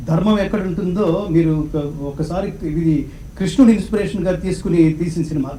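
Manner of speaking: ordinary delivery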